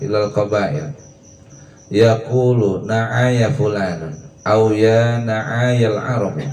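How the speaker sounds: slow, measured reading of classical Arabic; slow Arabic text recitation. Acted. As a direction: recite the Arabic quote slowly and deliberately, like reading from a kitab. Reads Arabic scholarly text.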